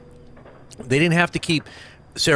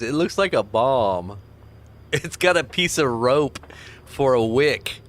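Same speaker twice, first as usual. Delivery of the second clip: ordinary talk, nothing marked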